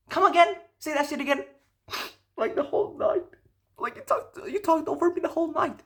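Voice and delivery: high incredulous pitch; disbelief. Real, not acted. Guy reacts in disbelief to date's accusation.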